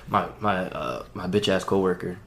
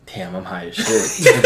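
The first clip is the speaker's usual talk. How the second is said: laughing slow realization; 8 semitones higher, much slower than usual. Retold or imitated. Realizing he's high on plane.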